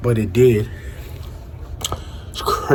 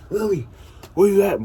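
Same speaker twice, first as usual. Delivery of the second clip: high, rising-pitched call for her; 9 semitones higher, faster than usual. Retold or imitated. Friend calling for Lily who just vanished, high alarmed call.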